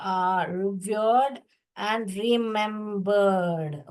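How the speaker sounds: very slow, word by word; very slow reading. Shown as a demonstration. Teacher reading the textbook line very slowly. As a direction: read it out slowly for the class. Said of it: "teacher reading text slowly".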